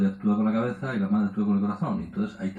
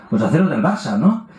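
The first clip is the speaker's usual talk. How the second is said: teasing advice; 4 semitones higher, 7 dB louder than usual. Retold or imitated. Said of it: "Yo les digo".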